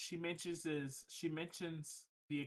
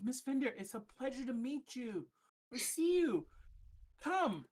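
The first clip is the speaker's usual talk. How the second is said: higher, eager welcome; excited; 8 semitones higher than usual. Acted. NPC greets the baker, higher excited welcome. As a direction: gush with delight at meeting her. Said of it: "She nods very, you know, excitedly".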